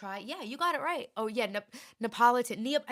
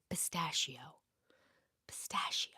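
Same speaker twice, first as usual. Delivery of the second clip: whispered; 7 semitones lower, 8 dB quieter than usual. Shown as a demonstration. Leading viewers to say it along, whispered. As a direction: breathe the word out like a secret. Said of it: "Nobody can hear you".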